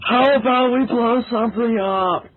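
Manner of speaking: high, loud gleeful shout. Acted. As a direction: yell the idea out. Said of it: "Michael's voice shouting".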